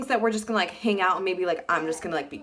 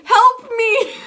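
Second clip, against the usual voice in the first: high, loud whiny plea; mock helpless girl; 12 semitones higher, 8 dB louder than usual. Mocking the girl texting her friend for help. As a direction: mimic a panicked girl begging for help.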